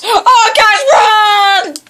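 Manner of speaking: gasp, then loud high yell; long-held cry of alarm; urgent warning shout. Real, not acted. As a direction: shout for everyone to flee. Warns others to run.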